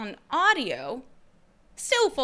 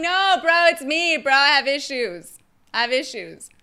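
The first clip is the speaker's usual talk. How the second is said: louder, higher, casual yelling back; retold loud reply; 3 semitones higher, 10 dB louder than usual. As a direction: yell it through the door, half laughing it off.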